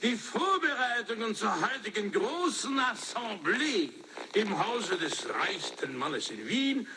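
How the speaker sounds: slow, lilting Viennese German; high-pitched Viennese greeting, easing down slowly; Viennese accent. Shown as a demonstration. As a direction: take your time in an opera-house Viennese accent. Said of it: "this is the way I do it with my Viennese accent".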